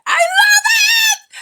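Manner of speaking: very high, drawn-out squealing yell; delighted shout. Real, not acted. Fan gushing over the couple's chemistry, shouting high. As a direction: belt it out in real delight.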